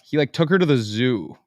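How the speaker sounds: normal talking voice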